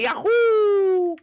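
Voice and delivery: high, loud cartoon yahoo; yahoo cheer. Luigi cheering to viewers in a high, loud yahoo. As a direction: leap into it like a jumping plumber.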